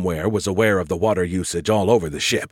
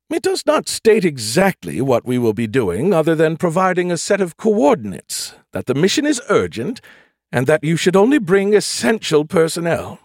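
higher, prim ship computer voice; 7 semitones higher, 4 dB louder than usual. A narrator's reading. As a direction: voice the ship's computer lady. Nagatha reports.

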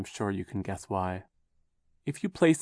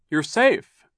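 higher, louder relieved exclamation; relieved guardian; 8 semitones higher, 11 dB louder than usual. A narrator's reading. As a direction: cry out in relief. Mister Poe greets rescued children.

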